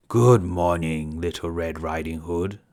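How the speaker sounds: slow, low gruff wolf. Acted. Wolf greeting the girl, slow and gruff. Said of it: "a gruff voice said".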